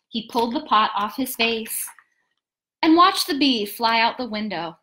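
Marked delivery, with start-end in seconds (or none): none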